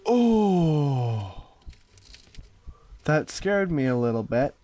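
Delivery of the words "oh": loud frightened cry; startled cry. Real, not acted. Startled by a jump scare.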